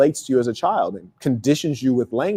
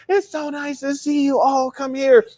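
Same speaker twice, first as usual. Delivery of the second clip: aunt greeting her nephew with delight; thrilled aunt; 10 semitones higher, slower than usual. Retold or imitated. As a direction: gush over the boy like a doting aunt. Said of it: "she was just so thrilled to see you".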